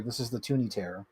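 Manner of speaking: normal talking voice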